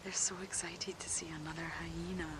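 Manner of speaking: hushed whisper watching the cubs. Real, not acted. Keeper quietly narrating hyena cubs meeting, whispered. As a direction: whisper it so they aren't disturbed.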